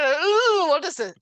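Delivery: high, loud startled ooh at the bite; mock shock at finding a worm; surprised ooh. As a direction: jump up high and loud, caught off guard. Biting into wormy apple.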